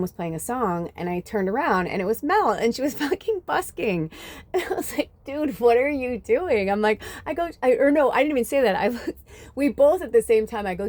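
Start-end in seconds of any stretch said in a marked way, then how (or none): 5.2-6.7 surprised greeting. Retold or imitated. Surprise meeting old friend busking.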